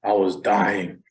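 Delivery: ordinary talk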